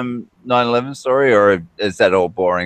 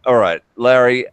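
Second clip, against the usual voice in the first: usual speaking voice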